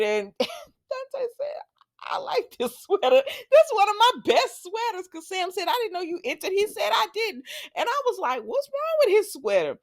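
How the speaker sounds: high, squeaky voice full of giggles; real laughing talk. Real, not acted. Recapper retelling an ugly sweater contest, cracking up. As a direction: struggle to talk through laughter.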